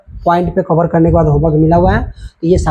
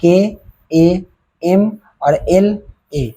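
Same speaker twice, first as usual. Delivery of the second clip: slow spelling; 2 semitones higher, much slower than usual. Shown as a demonstration. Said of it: "spelling out letters slowly".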